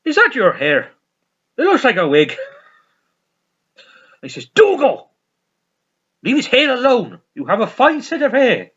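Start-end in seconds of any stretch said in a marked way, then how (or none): 0.0-2.4 higher, louder put-on naive question; lightly voiced character blurting a question; Dougal. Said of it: "Dougal looks at his hair, he goes". 4.5-8.7 high, raised telling-off; hammed-up exasperated reprimand; acted scolding. Acted scolding of Dougal in sitcom.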